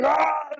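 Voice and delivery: short high yell; madman scream. Pretend. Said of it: "you should be screaming".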